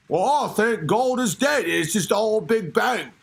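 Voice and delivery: exaggerated British Hawking answer; hammed-up British pronouncement; mock British accent. Host as Hawking answering on the world, mock British accent. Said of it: "sound like a British".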